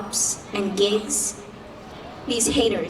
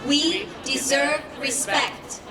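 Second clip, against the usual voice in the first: slow, higher-pitched formal statement; solemn declaration; 4 semitones higher, slower than usual. Acted. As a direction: declare it slowly, word by word. Closing declaration in slow measured style.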